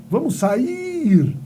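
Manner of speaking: SAIR com o I bem esticado; hiato destacado. Shown as a demonstration. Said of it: "É hiato?". ("sair" com o I tônico alongado)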